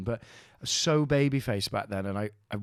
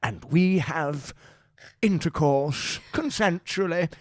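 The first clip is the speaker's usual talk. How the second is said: slow, raised, stretched-out documentary narrator; measured wildlife-presenter impression, pitched up; David Attenborough; 6 semitones higher, 5 dB louder, much slower than usual. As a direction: narrate it like a nature film, very drawn out. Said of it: "David Attenborough".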